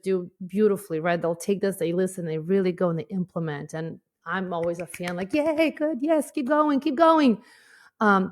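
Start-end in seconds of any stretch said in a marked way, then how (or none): 5.3-7.4 higher, bright cheer of encouragement; root for them like a fan in the stands. Retold or imitated. Host cheering on clients who do the work, excited.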